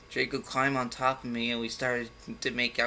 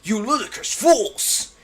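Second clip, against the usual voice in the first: slow, high, loud furious shout; hammed-up angry headmaster; 8 semitones higher, 8 dB louder, slower than usual. A narrator's reading. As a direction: bellow it at the fools. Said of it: "he shouted".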